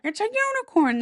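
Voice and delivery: high, stubborn king; the king insists, pitched high; crazy king again. Retold or imitated. A mad king repeats his claim to the courtiers, high.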